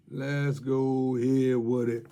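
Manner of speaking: slow, stretched catchphrase; slow teasing sign-off line; drawn out. Acted. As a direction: savour every word like a tagline.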